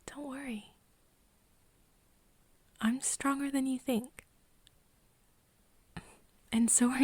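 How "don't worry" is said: very soft, slightly raised voice; hushed, tender comfort; gentle reassurance. Acted. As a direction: softly soothe someone who is nervous. Reassuring partner before blood test.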